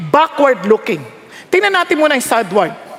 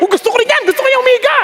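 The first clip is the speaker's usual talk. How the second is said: mock eager admiration; 9 semitones higher, much faster than usual. Re-enacts admiring a reclining preacher.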